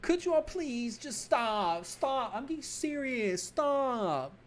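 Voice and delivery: high-pitched whining plea, slow; whiny pleading. Acted. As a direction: whine at them to stop like a hurt kid. Officer whining to mocking colleagues.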